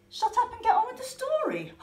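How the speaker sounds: high, loud and fast rude snap; a cheeky puppet barking at the host. Acted. Pink Bunny tells the host to get on with it, high and rude.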